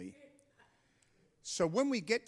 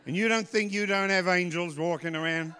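loud, raised preacher's challenge; emphatic preaching; 3 semitones higher, 7 dB louder than usual. Real, not acted. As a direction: shout the question out to the congregation. Preacher driving the point home.

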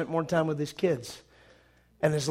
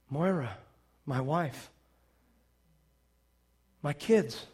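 soft, low, halting recollection; dawning memory; 3 semitones lower, 8 dB quieter, slower than usual. Retold or imitated. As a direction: let each memory surface quietly, with pauses. Peter slowly remembering his family.

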